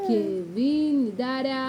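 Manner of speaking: usual speaking voice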